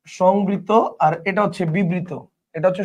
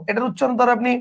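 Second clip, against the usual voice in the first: ordinary delivery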